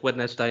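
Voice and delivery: "Wednesday" as Wed-nes-day, D sounded; said as spelt. Acted. Friend making plans for next week, "Wednesday" as Wed-nes-day. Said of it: "say words the way that they're spelt".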